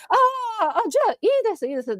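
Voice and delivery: high, whimpering resignation that fades out; mock tearful giving up. 元彼の要求に泣きそうに折れるふりで、甲高く泣き声で. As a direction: pretend to crumble and give in.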